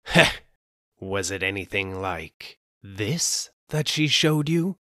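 loud "Heh", then slow, sly question; eerie mock. Soba seller slyly taunts the terrified man. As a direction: toy with him before the reveal. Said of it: "cried the soba man".

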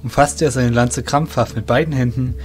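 ordinary talk, nothing marked

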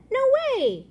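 high, loud protest; cried out. Acted. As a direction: blurt it in mock horror. Simon cried out.

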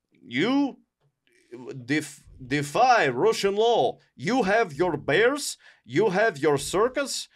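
put-on slow, accusing Russian accent; mock Russian extremists. Extremists accusing the Tsar of breaking law, Russian accent. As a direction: lay out charges slowly in a thick put-on Russian accent. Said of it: "they were just like".

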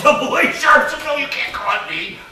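very high, loud comic outburst; mock exasperated insistence. Acted insisting to the pianist.

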